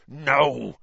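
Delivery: growling. A narrator's reading. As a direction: growl out a firm refusal. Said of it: "growled Hagrid".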